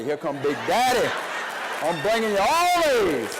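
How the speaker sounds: building to a loud, drawn-out call; boyish boast. Retold or imitated. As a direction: call out proudly to mama, stretching it. Boy calling out to his mama.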